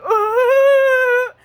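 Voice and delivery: high, loud mocking "Ah!"; mock laughing shriek. Workers laughing at her, high loud mocking shriek. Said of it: "laughing at me like".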